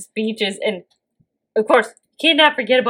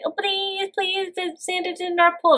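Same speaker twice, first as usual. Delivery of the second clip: mock children; 10 semitones higher, 4 dB quieter than usual. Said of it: "the children are saying".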